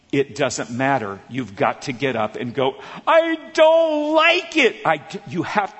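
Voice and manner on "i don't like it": put-on whiny kid. Son whining to mom.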